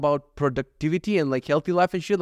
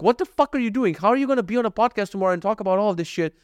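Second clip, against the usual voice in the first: exasperated inner voice, pitched high and rushing; mock self-scolding; 5 semitones higher than usual. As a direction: scold yourself in a high, hurried voice. Scolding himself in his head.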